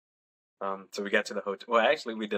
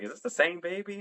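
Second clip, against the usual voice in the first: confused family; 10 semitones higher, 3 dB quieter than usual. Retold or imitated. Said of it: "everybody's like".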